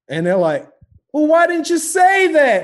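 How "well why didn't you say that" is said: high, loud, drawn-out imitation of cops; mock friendly cop. Cops learning who his father is, mock friendliness. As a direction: stretch it out, suddenly all chummy.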